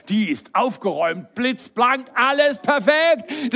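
hammed-up, high, loud delight; gushing praise. Parent praising the ceiling in a messy room, gushing. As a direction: build to an over-the-top rave.

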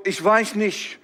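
normal talking voice

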